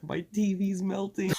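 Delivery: high-pitched stoned alarm; freaking-out friend. Retold or imitated. Imitating stoned friend on party chat, high freaked-out voice. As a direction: mimic a tripping friend in a high voice. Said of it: "he's, like, freaking out".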